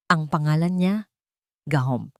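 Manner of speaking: usual speaking voice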